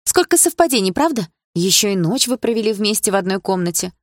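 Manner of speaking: biting sarcasm. Acted. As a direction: needle him with exaggerated disbelief.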